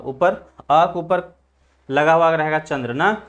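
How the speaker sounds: usual speaking voice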